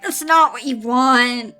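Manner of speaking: usual speaking voice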